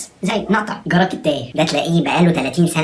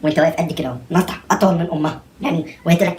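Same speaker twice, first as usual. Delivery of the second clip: normal talking voice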